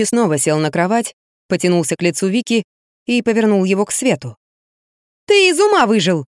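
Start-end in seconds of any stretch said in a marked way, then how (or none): 5.3-6.3 high indignant cry; indignant outburst. Acted. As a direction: brush him off in outrage. Pushing his hand away.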